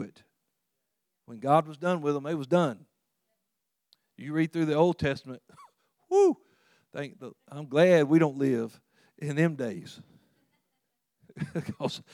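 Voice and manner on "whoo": high, loud laughing whoo; laughing whoop. Real, not acted. Preacher whooping with laughter at old punishments.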